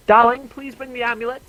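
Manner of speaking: high, loud merchant lady's call; calling a servant. Acted. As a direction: call out sweetly to someone in the next room. Merchant calls a servant through wall.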